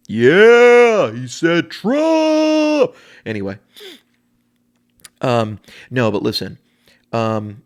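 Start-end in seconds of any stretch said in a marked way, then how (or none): 0.1-2.9 long, high, loud mock cheer; hammed-up whooping supporter; imitation of cheering fan. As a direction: holler it with hugely stretched words. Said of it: "my imitation of people who feel two ways".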